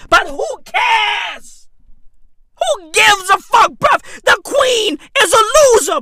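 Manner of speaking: loud, high comic ranting; high shouted mock tirade. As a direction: yell it like an outraged fan. Comic rant shouting at Queen.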